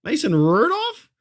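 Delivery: slow, very high incredulous mock question; drawn-out high mock pundit question; mocking pundit inflection. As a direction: parody a pundit overselling disbelief. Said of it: "they use, like, voice inflection".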